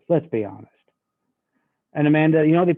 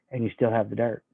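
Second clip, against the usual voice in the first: gently, softer and lower; 5 semitones lower, 7 dB quieter, faster than usual. Shown as a demonstration. Coach showing sales pitch, soft tone. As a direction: state it softly, no push. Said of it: "in a soft tone".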